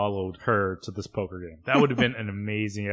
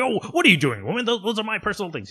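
higher, louder mock-pompous outrage; indignant Frasier; 10 semitones higher, 5 dB louder, much faster than usual. Frasier scolding his housekeeper, pompous mock outrage. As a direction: play Frasier scandalised by the laundry. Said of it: "And Frasier's at first like".

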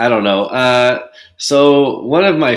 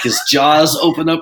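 ordinary delivery

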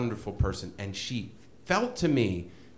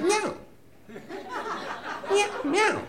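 high, squeaky cat meow; mock meowing like a cat; high falsetto kitten meow; imitating a meow; slower than usual. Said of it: "And he meowed".

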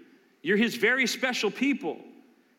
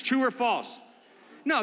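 ordinary talk

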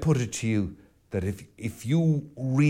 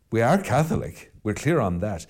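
normal talking voice

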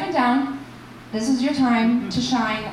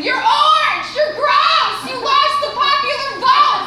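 high, loud protest chant shout; imitated crowd yelling; 14 semitones higher, 6 dB louder, slower than usual. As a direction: yell it with the whole march. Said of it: "everyone was just yelling".

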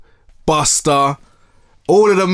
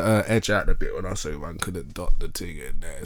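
quiet aside; 11 semitones lower, 9 dB quieter than usual. Real, not acted. Aside asking for edit.

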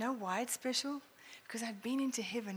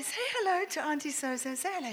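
put-on higher sing-song coaxing of a toddler; coaxing baby talk; 7 semitones higher than usual. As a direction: coax the baby to say hello. Mother coaxing her baby.

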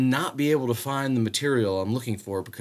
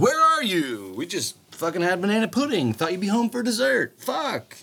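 mock nagging text; 9 semitones higher than usual. Quoting angry texts from home.

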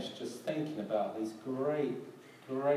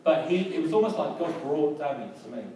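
rising, louder, eager; lifted, louder telling of God's timing; excited; 4 semitones higher, 8 dB louder than usual. Real, not acted. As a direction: burst out with wonder. Excited about Danny's visit.

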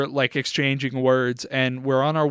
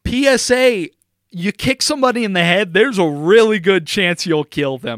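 raised, louder public warning; emphatic PSA; 4 semitones higher, 5 dB louder than usual. Real, not acted. Host warns listeners about kicking heads, emphatic PSA delivery. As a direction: announce it firmly as a warning.

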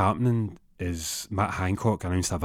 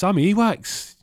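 high, loud outburst; acted-out loud objection; indignant protest; 12 semitones higher, 6 dB louder, slower than usual. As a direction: voice shoots up in mock outrage. Acted protest in breakup call.

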